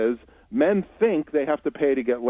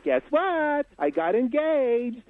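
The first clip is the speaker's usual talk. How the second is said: very high mock-girlish squeal; hammed-up woman announcing big news; slow, high falsetto imitation; excited fiancée; 10 semitones higher, slower than usual. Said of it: "A woman goes to her group of girlfriends and says".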